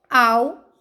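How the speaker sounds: al said loud and alone; teacher isolating a strong al; isolated stressed syllable. Shown as a demonstration. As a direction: punch the syllable al. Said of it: "penúltima sílaba".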